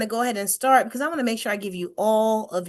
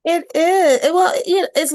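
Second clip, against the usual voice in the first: a hint of Jamaican lilt, louder and higher; light Jamaican accent; 7 semitones higher, 7 dB louder, slower than usual. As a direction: playfully slip into a Jamaican accent. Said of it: "I hear a little Jamaican, you know, accent".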